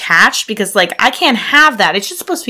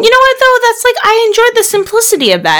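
excited; 13 semitones higher, faster than usual. Real, not acted. Sudden enthusiastic praise.

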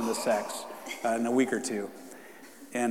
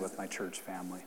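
whispered aside; 5 semitones lower, 12 dB quieter than usual. Real, not acted. Pastor whispering to the church, intimate aside. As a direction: drop to a whisper for the last words.

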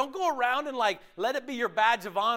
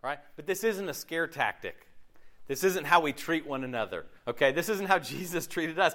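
normal talking voice

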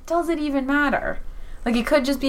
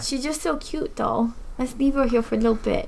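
cat voice. Pretend. Player begs to keep the cat here, in cat voice. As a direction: plead lightly for the kitty to stay. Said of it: "That's my cat voice".

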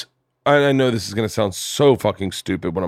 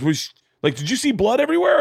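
doctor's question climbing way up in pitch; incredulous doctor; 12 semitones higher than usual. Retold or imitated. Doctor grilling the patient about blood, climbing incredulous pitch. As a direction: ask it like you can't believe the answer.

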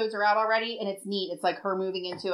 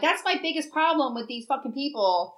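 normal talking voice

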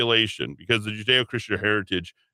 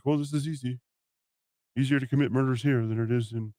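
lightly voiced smug killer, a bit softer; mock murderer; 3 semitones higher, 3 dB quieter than usual. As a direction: sneer it softly as the smug killer. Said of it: "He's like".

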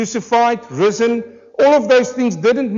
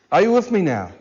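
ordinary talk, nothing marked

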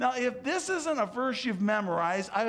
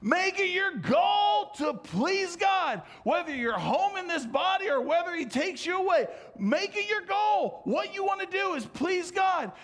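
fiery preaching; 10 semitones higher than usual. Acted. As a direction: proclaim it to the congregation. Preaching opening at full force.

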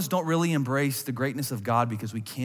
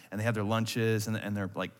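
ordinary talk, nothing marked

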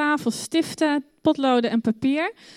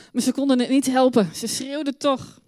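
ordinary delivery

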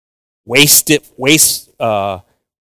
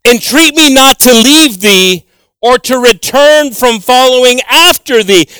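higher, louder and slow, like reading from the pulpit; solemn scripture reading; 9 semitones higher, 5 dB louder than usual. Acted. Preacher reads Ruth's vow to the congregation, solemnly. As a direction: proclaim the verse with weight.

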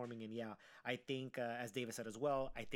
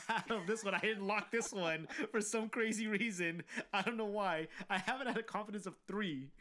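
laughing; 8 semitones higher, 3 dB louder than usual. Real, not acted. Laughing at own bad bet.

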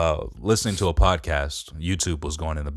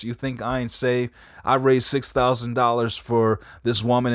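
cussing pastor; 6 semitones higher than usual. Retold or imitated.

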